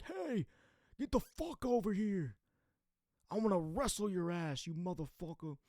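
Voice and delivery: put-on high, far-off drunk yell across the lot; drunk guy yelling. Drunk stranger yelling across the parking lot, rowdy high yell. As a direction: call him over like a drunk looking for a fight. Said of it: "He's like".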